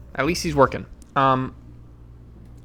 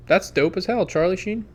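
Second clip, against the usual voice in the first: ordinary delivery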